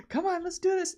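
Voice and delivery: high-pitched imitation of an encouraging mother; coaxing mom. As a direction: urge a reluctant child on, high-voiced. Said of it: "trying to get his, her kid to".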